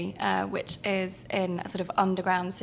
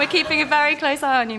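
higher and louder mock threat through a laugh; mock menacing; 6 semitones higher, 6 dB louder than usual. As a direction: warn him off like a pantomime villain, half laughing. Playful mock threat to host.

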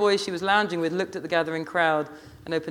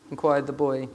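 ordinary delivery

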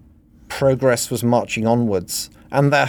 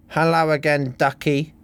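slurred; 6 semitones higher than usual. A narrator's reading. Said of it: "Missus Bass slurred".

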